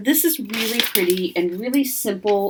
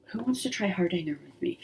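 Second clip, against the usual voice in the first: playful whisper; 9 dB quieter, faster than usual. Acted. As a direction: whisper the invitation, quick and conspiratorial. Playful invitation to viewers.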